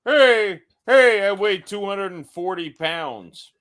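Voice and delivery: mocking Bert Kreischer's boast, hammed-up; imitating Bert. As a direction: puff up like a shirtless comic bragging. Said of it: "he's like".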